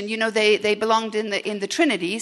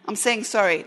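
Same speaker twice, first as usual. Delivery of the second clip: ordinary talk, nothing marked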